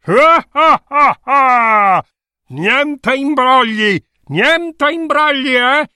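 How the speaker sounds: mock booming monster laugh then threat; a hammed-up beast warning.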